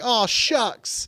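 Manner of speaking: high, hammed-up aw shucks voice; an exaggerated high folksy shucks; mock aw-shucks. Mock disappointment after losing deal.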